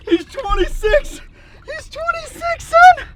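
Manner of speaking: high-pitched, rising excited announce; thrilled. Real, not acted. Diver measuring his grouper, thrilled squeal. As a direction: squeal the size with glee.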